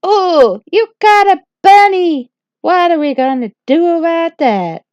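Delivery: loud, shrill-pitched fairy gasp; high, loud gossiping fairies. Acted. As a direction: shriek it out like nosy fairies who found a scandal. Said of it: "they were like".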